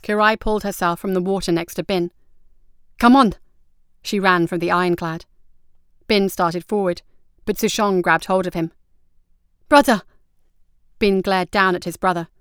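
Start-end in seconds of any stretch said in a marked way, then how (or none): 3.0-3.3 high, loud rallying call; urgent shout. Acted. Kirai climbs from water and shouts Bin onward. 9.7-10.0 high, loud desperate call; pleading cry. Acted. Sushong grabs hold of Bin, crying out to him.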